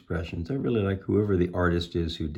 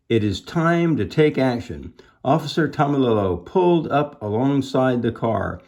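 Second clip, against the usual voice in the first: animated, emphatic chapter reading; children's story narration; 5 semitones higher, 6 dB louder than usual. A narrator's reading. Officer Tomilello questioning himself, lively storytelling. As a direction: lift the officer's self-questions, answer firmly.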